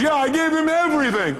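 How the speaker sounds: high-pitched, overwrought wail of complaint; hammed-up imitation of an aggrieved caller; upset caller. Upset caller answering his question, high mock wail.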